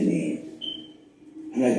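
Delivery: ordinary talk, nothing marked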